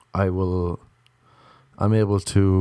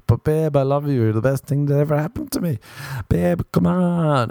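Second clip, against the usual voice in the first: high-pitched mock pleading; pleading boyfriend; 6 semitones higher than usual. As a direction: whine to your babe, dragging out the last plea. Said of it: "the men are going to be forever being like".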